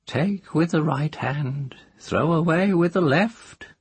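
put-on high, teasing old witch; a cackling village witch. A narrator's reading. Moss proverb.

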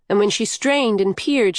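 ordinary delivery

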